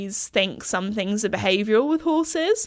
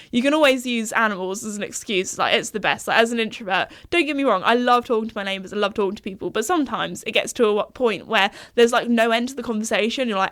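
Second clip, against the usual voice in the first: ordinary delivery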